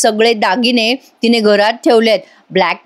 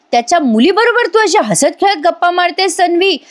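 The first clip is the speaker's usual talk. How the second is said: higher, accusing rebuke; hammed-up outraged question; scolding father; 7 semitones higher than usual. Father-in-law scolding Tanvi for chatting with the enemy's daughter, reproachful.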